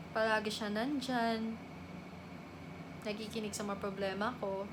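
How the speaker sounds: soft, low, subdued reminiscence; wistful longing. Acted. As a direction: speak quietly of a missed brother. Missing her supportive older brother. (mahinang pangungulila)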